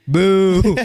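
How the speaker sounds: playful loud jeer; jeering boo. Real, not acted. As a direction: boo like a rowdy crowd member. Heckling the wasp sympathizer.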